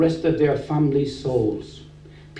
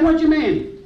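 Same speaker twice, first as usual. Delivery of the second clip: higher, full-voiced challenge back; indignant retort; 7 semitones higher than usual. Man answers a pastor he finds cheeky, indignant retort. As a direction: throw the question back, affronted. Retold retort, repeated more strongly.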